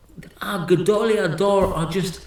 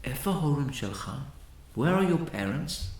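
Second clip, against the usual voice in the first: gentle question; 3 semitones lower, 6 dB quieter than usual. Retold or imitated. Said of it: "Rav Ovadia looks at this kid and he says".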